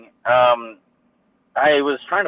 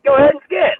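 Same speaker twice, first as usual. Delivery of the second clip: much higher, snide put-on voice; mocking staff guy; 12 semitones higher, 5 dB louder than usual. Imitating the employee following him, snide. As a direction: sneer it like a petty guard. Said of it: "He's like".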